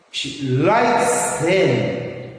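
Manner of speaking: very slow, drawn-out "likes" in "She likes them"; sentence stress demo. Shown as a demonstration. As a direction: lean hard on "likes" for learners. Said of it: "where you have to stress".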